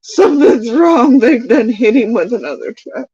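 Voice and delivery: high, rising mock panic; imitating her own frantic worry; retold panic. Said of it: "I was like".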